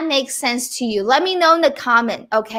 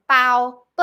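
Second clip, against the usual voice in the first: ordinary talk, nothing marked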